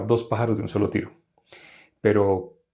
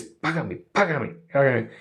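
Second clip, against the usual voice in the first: creditor choking debtor; 3 semitones higher, slower than usual. Acted. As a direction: press repeatedly as if throttling the debtor. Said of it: "le ahogaba diciendo".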